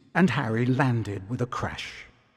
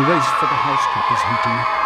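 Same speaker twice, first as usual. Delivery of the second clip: announcer call; 6 dB louder than usual. Acted. Announcer calls the House Cup race.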